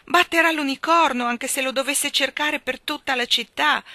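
loud, high-pitched worried outburst; anxious cry. A narrator's reading. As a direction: call out in alarm, easing slightly. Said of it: "gridava Jane ansiosa".

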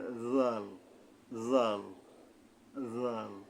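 জাল with the tongue at the upper front teeth, a soft voiced th; soft Arabic dhal. Shown as a demonstration. Teacher demonstrating the letter dhal, soft voiced th. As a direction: ease জাল out gently with a dh sound. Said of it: "নরমভাবে আদায় হবে".

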